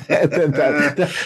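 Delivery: laughing through words; chuckling as he talks. Real, not acted.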